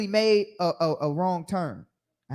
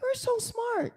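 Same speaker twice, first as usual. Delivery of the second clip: much higher, soft, slow mock praise; 6 dB quieter, much slower than usual. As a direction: coo it like praising a toddler.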